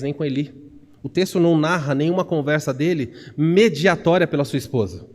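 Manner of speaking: normal talking voice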